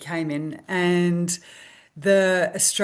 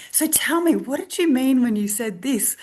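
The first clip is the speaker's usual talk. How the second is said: eager curiosity; 6 semitones higher than usual. Retold or imitated. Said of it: "And I was like".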